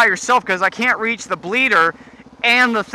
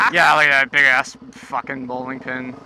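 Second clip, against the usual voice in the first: usual speaking voice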